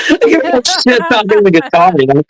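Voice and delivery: high-pitched retold telling-off; mock scolding. Owner telling off the possessed guitar, mock scolding. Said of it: "I'm scolding her like a little kid".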